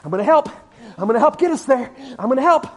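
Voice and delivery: hammed-up helpful passenger; silly eager passenger. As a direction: play the fool who thinks he helps fly. Mock flapping-wings passenger.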